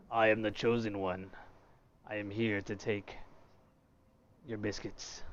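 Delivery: low, quiet mock villain voice; deep villain voice. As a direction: play the chosen one boss. Voicing the game boss.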